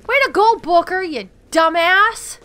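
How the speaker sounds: loud, dragged-out mock praise; sarcastic scolding. Real, not acted. Scolding companion after bad kill.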